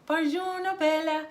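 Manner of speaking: high, loud Italian street greeting; Italian kid greeting. Retold or imitated. Kid greeting a tourist, high and loud. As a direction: sing it out like a passing kid. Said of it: "A kid passing you on the street".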